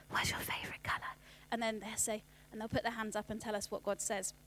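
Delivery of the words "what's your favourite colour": soft secret whisper, no voice at all. Shown as a demonstration. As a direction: whisper it into cupped hands like a small child. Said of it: "we get them to whisper into their hands".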